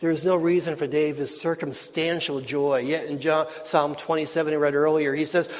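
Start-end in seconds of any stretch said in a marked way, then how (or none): none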